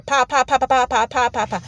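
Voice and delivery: pa pa pa gun sounds; gunshot noises. Retold or imitated. Imitating shots fired into the air. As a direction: mimic rapid gunfire.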